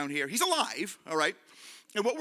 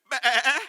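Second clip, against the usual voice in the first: loud, high imitated bleat; comic "baa"; sheep bleat; 5 semitones higher, 10 dB louder than usual. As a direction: bleat like a lamb. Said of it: "we're gonna go".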